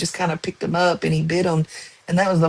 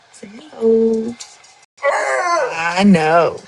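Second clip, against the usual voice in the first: higher, slow sing-song to the dog; pet coaxing; 4 semitones higher, much slower than usual. Real, not acted. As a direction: coax the pet gently along.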